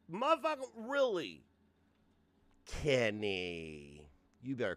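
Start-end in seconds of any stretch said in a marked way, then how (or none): 0.1-1.4 incredulous. Real, not acted. Incredulous repeat.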